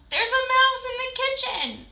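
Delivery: high, loud panicked announcement; wife shouts to her husband about the mouse, high and loud; alarmed shout. A narrator's reading. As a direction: yell the news in a fright. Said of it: "shouted Missus Newcomb".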